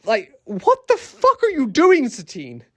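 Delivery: high, slow disbelieving cry; exasperated outburst. Real, not acted. Vlogger yelling at Satine on screen, high and exasperated. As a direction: throw up your hands at her, slowly.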